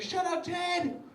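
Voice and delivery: high-pitched mock angry snap, stretching out the end; aggressive sister. Sister hitting back at vegan brother, aggressive. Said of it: "She came at me hot".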